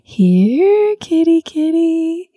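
high, slow coaxing call to a cat. Shown as a demonstration. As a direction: gently lure the kitty over. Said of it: "you do this thing where you go".

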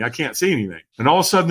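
ordinary delivery

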